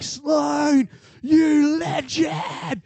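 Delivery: high, loud, long-drawn fan cheer; imitated screaming. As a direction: scream it from the stands in adoration. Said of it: "standing in the stands screaming".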